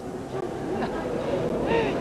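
a short breathy giggle; real stifled giggle on air; amused laugh. Real, not acted. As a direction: laugh at your own wording. Presenter amused by her own phrase.

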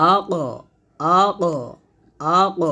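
আ ক in separate thick bursts with an echoed release; punched, repeated আ ক with a rebound; thick, bouncing q. Shown as a demonstration. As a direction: bounce the ক heavily off the tongue root. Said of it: "মোটা করে".

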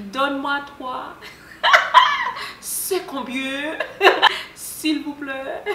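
giggling through her own French; foreign accent in french. Retold or imitated. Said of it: "not me trying to speak French".

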